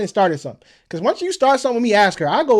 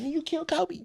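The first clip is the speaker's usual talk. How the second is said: mock accusation; 11 semitones higher, 5 dB quieter than usual. Imagined accusation from onlookers.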